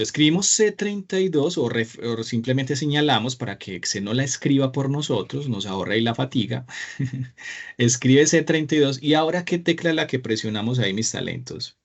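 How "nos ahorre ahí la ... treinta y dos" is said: ordinary delivery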